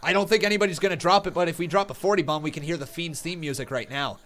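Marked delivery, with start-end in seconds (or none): none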